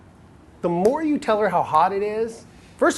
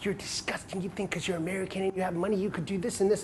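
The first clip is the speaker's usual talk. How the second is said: voiceless, softer retelling of her scorn; hushed retold insult; 8 dB quieter than usual. Retelling a Romanian girl's scorn in a voiceless hush. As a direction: hiss her contempt back quietly. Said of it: "all she told me".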